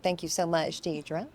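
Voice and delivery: usual speaking voice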